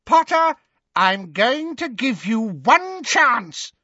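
high, drawn-out villainous shout. A narrator's reading. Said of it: "shouted Bellatrix".

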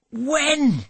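high, bright eager question; a husband thrilled at the news. A narrator's reading.

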